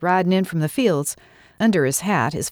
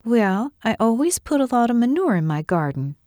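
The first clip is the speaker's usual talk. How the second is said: higher-pitched, mildly pleased with yourself; understated smug pride, pitched up; a little smug; 6 semitones higher than usual. A narrator's reading. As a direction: explain it with a touch of smugness. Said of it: "maybe a little smugly".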